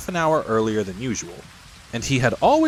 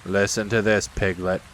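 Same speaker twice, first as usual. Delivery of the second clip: low, gloomy donkey voice; Eeyore instructing; 4 semitones lower than usual. Acted. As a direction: drone it low and evenly. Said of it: "said Eeyore".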